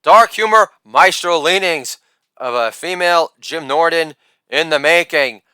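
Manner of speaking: usual speaking voice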